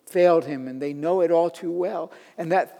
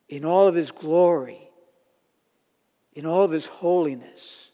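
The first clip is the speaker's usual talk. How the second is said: normal talking voice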